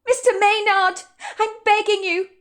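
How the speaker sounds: high, pleading, near tears; a waitress begging her manager, near tears; tearful pleading. Acted. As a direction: beg him not to fire you. Madison pleads for her job.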